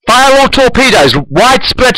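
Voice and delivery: high, pushed firing order; captain's shout. A narrator's reading. Captain ordering torpedoes fired at enemy ships, shouting. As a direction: shout the command across the bridge. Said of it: "shouts the captain".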